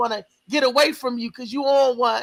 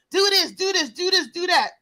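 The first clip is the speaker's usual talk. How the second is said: mock bossy partner, pitched higher; higher, mock commands, quick and repetitive; bossy orders; 6 semitones higher than usual. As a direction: rattle off orders like a nagging mate.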